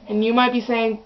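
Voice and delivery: ordinary talk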